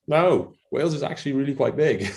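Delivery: retold objection with a laugh behind it; mock protest. Welsh farmer correcting people who think Wales is tiny, mock protest.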